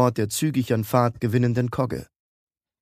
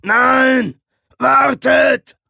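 high, loud desperate yell; bellowing shout; 14 semitones higher, 9 dB louder, much slower than usual. A narrator's reading. Orc's friend yells after the departing ship, bellowing. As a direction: bellow after someone who is leaving. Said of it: "brüllten jetzt gemeinsam".

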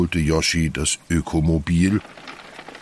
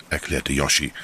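usual speaking voice

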